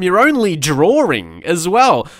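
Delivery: ordinary talk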